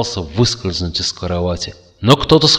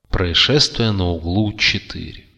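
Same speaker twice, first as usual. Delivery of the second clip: usual speaking voice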